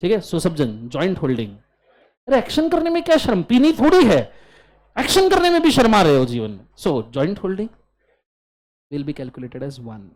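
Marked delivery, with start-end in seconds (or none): 2.3-6.6 exasperated scolding. Real, not acted. Teacher scolds shy students.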